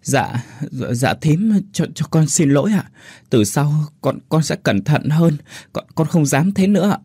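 high, stuttering frightened apology; a scared girl tripping over her words; stammering in fear. A narrator's reading. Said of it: "Kiều Liên miệng lắp bắp".

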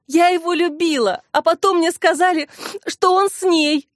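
high-pitched tearful lament; narrated wail, breaking off mid-confession; sobbing. A narrator's reading. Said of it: "рыдала Клара". (плачущим голосом)

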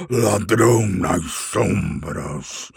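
slow, sneering dragon taunt; gloating dragon mocking a thief; boastful dragon. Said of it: "Vangloriou-se". (dragão se vangloriando)

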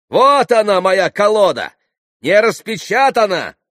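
high, loud exclamations; triumphant exposure. A narrator's reading. Landlord holds up the unopened deck, exclaiming. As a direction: proclaim the proof loudly to everyone.

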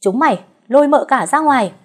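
loud, high shouted order; shouting. A narrator's reading. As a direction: bark the order at the servants. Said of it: "vội hét lên". (ông Phú hét ra lệnh)

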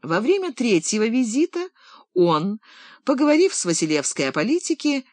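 plain reading voice

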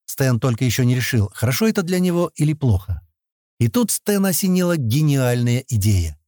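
usual speaking voice

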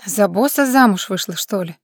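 plain reading voice

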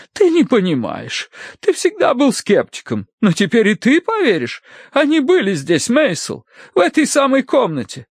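high, fervent lady's voice; exhorting wife. A narrator's reading. Wife persuades husband the spirits came, exhorting. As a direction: plead your case with rising drama. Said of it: "принялась увещевать она".

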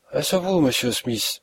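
usual speaking voice

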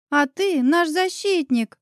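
measured mock importance; self-important. A narrator's reading. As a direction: say it importantly, as if to a child. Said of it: "важно проговорила Оля".

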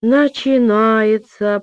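put-on slow, high grumble of a child; a little girl grumbling. A narrator's reading. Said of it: "проворчала маленькая Аня".

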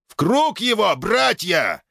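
rally the brothers; rallying shout. Acted. Knight rallies brothers. (боевой клич)

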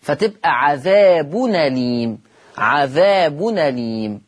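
slow recitation, عذاب أليم as ʕaðabu-nalim; slow Quranic reading, عذاب أليم with the nun opened; naql demo. Shown as a demonstration. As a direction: join the nun of عذاب to أليم with a fatha, drawn out. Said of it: "أحطها على النون".

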